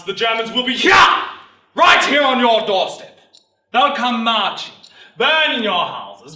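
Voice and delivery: loud, fierce recruiting harangue; rabble-rousing military orator; sergeant major, alarming the crowd. Acted. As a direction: hammer each threat home, dropping on the last.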